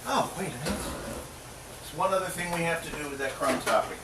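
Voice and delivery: usual speaking voice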